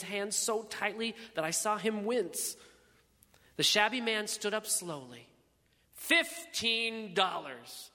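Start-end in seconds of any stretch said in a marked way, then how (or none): 5.9-7.9 very slow, higher, weighty pronouncement; grand finality. A narrator's reading. Shabby man standing to close the auction, grand finality. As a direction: draw out each word to end the bidding. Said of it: "he said with grand finality".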